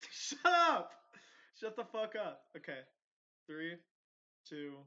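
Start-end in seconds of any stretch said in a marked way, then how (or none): 0.1-0.9 high, very loud yell; exasperated shout. Real, not acted. Host silencing joking co-hosts before recording, exasperated shout. 1.6-2.4 raised, loud order; exasperated yell. Real, not acted. Host quieting co-hosts before the countdown, exasperated yell.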